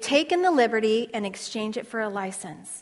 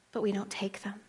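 whispered point; 2 semitones lower, 11 dB quieter than usual. Acted. Soft emphasis during the sermon.

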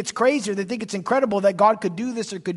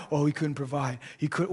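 softer, lower doubting voice; mock doubter; 5 semitones lower, 5 dB quieter than usual. Preacher voicing a doubter of God's provision, quietly doubtful. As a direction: lightly imitate someone unsure.

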